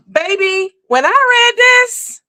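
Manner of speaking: very high-pitched, drawn-out disbelief; exaggerated astonishment. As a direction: squeal it high and slow, hamming up the shock. Exaggerated shock at the message.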